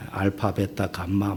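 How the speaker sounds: low, soft recitation of letters; imitated rote memorizing. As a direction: imitate someone memorizing under their breath. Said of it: "막 이러면서 외워요". (작고 낮게 중얼중얼 외우는 흉내)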